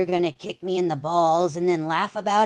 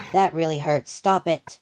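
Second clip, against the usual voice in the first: normal talking voice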